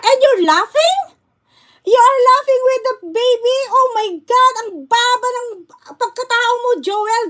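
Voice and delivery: aghast at what he is watching; outraged disbelief. Real, not acted. Vlogger scolding a streamer who laughed at a baby, outraged disbelief.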